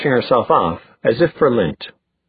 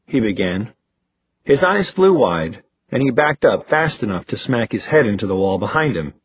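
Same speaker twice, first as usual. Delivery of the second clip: usual speaking voice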